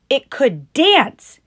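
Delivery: high, loud and slow ad pitch; toy commercial voice. Acted. Showing off the doll's features, high loud ad voice. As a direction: hype each feature like a toy advert.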